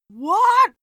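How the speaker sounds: high, loud shriek of shock; shocked, high-pitched yell; screamed. A narrator's reading. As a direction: scream it in disbelief. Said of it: "Philip screamed".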